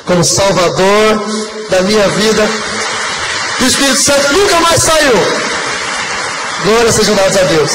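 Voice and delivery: swelling preacher's proclamation; preaching exclamation. Real, not acted. Testimony climax, praising God aloud.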